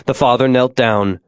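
ordinary delivery

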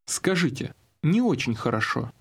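ordinary talk

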